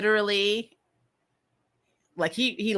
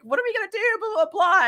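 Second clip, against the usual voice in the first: much higher, mocking the panicking people; mock panic; 12 semitones higher, faster than usual. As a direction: whine it like a flustered crowd.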